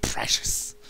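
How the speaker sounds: breathy Gollum hiss on precious; whispered creature aside; Gollum impression. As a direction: rasp it like a creature hoarding treasure.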